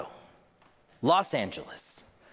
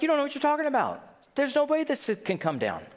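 high, quick scoffing retort; dismissive disbelief; 10 semitones higher than usual. Acted. Listeners doubting Jesus about the temple, dismissive. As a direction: brush it off in a raised pitch.

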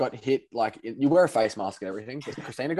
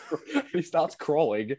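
ordinary talk

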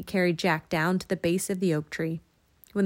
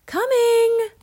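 high, loud answering call; long, high-pitched shout; 9 dB louder than usual. A narrator's reading. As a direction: holler back out the window. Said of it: "and shouted".

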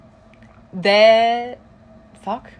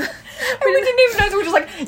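laughing through words; 12 semitones higher than usual. Real, not acted. Laughing while telling story.

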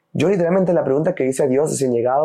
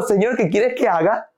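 eager prayer; 8 semitones higher than usual. Retold or imitated.

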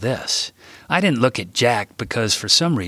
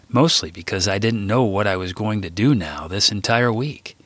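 ordinary delivery

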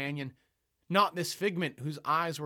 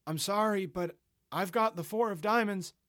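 polite insistence; 6 semitones higher than usual. Acted. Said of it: "I repeated myself".

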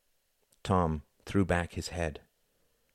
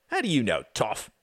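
mildly rough demand, pitched up then falling; 4 semitones higher than usual. A narrator's reading. One of the men questioning Tom, demanding. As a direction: challenge him, starting high. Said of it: "demanded one of them".

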